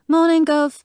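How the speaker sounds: high, loud cheerful call; bright high call of Morning, Gov; called greeting. Acted. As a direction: sing it out across the office. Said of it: "called as she slid".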